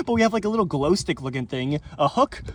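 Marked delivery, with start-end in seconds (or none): none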